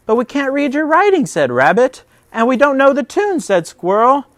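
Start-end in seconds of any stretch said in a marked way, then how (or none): 0.1-1.3 high, loud, fast protest; anxious protest. Acted. Rabbit protesting to Bear, fast high panic. 2.3-3.4 high, loud, fast protest; anxious protest. Acted. Squirrel protesting to Bear, fast high panic.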